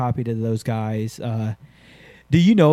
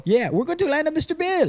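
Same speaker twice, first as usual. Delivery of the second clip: mock jumper voice; 14 semitones higher than usual. Quoting jumpers' plan in mock voice.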